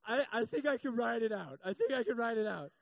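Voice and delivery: stubbornly brushing it off; swollen-mouthed insisting. Retold or imitated. A swelling husband telling his wife he can ride it out. Refusing the emergency room.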